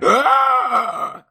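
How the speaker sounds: high, loud ghostly shriek of pain; ghost cry. Acted. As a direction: let out a piercing wounded ghost cry. GM voices ghost reeling back.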